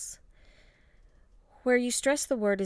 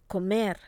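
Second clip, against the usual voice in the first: "Comer" with the weight on MER; stress on last syllable; 4 dB louder than usual. Shown as a demonstration. Teacher models the rule for repeating, last-syllable stress. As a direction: punch the second syllable of "Comer". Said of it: "you must stress the last syllable".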